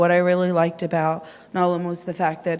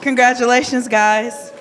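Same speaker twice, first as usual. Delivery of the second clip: excited congratulations; 6 semitones higher, 8 dB louder than usual. Real, not acted. Congratulating the winners.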